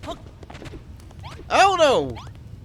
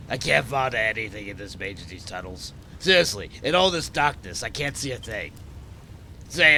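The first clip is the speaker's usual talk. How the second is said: husky put-on French-ish accent; low raspy smoker accent; 4 semitones lower, 4 dB quieter than usual. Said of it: "my accent is just smoker voice".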